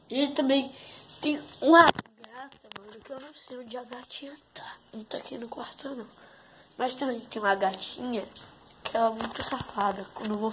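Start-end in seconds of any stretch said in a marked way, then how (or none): 2.8-6.1 soft unvoiced whisper. Real, not acted. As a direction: whisper so the cat won't hear. Whispering where the cat is. (sussurro baixinho)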